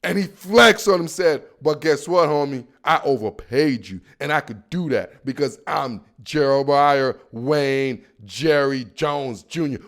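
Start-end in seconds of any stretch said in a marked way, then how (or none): 1.6-9.8 lightly voiced smug team owner, low; mock a boastful boss, pitched down a little; Jerry Jones. Owner flexing on his quarterback, lightly imitated low boast. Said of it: "He's like".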